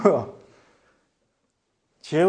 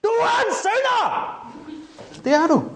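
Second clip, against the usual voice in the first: preacher's shout. Shown as a demonstration. Preacher demonstrating shouting at the congregation. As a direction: yell the accusation at the crowd. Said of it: "råbe og skrige af folk".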